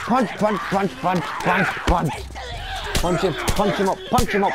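high, loud frantic chant; urgent, panicked punching calls; frantic shouting. Real, not acted. Frantic fight with zombie.

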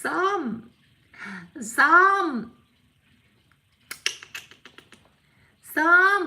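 loud, high call across the room. Real, not acted. Owner calling loudly for someone across the house, high loud call.

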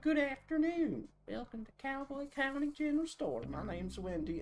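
high cheery country shopkeeper voice; chirpy country store clerk greeting; country accent. Acted. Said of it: "I'm already doing a country accent".

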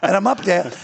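ordinary delivery

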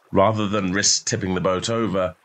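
usual speaking voice

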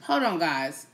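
ordinary talk

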